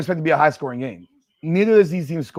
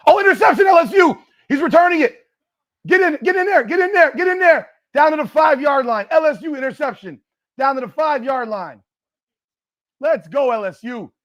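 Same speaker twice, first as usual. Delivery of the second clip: much higher and louder, yelling at the screen; excited shout; 11 semitones higher, 6 dB louder than usual. Real, not acted. Fan yelling at the TV as LSU returns the pick, thrilled. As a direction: cheer the return play by play, urging him on. Live interception during watched game.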